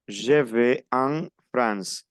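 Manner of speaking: slow, careful dictation of Je vais en France; slow model sentence. Shown as a demonstration. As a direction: spell out Je vais en France slowly for students. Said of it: "yo completo con, a ver, la preposición an". (dictado lento y claro)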